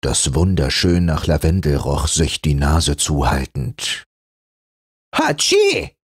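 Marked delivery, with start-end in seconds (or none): 5.1-6.0 a big high acted sneeze; red flannel sneezing. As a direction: sneeze at the camphor. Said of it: "machte der rote Flanell".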